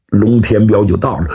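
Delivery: ordinary delivery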